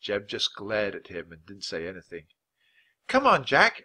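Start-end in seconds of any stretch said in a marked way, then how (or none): 3.1-3.8 put-on high, loud girl's call. A narrator's reading. Girl outside calling Jack to lunch, calling out. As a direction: call your friend to come along. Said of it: "Annie called outside the hut".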